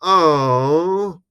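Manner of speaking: high, drawn-out mock "oh"; children's collective groan. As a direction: imitate a crowd of disappointed children. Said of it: "they all go".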